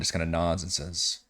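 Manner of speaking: ordinary delivery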